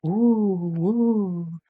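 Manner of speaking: long swooping "woo" like wind; airy wind howl, rising and falling. A narrator's reading. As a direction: imitate the wind in drawn-out hoots. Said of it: "blew the wind in the trees".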